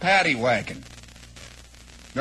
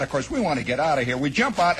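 ordinary talk

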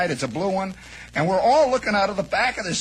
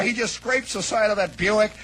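ordinary talk, nothing marked

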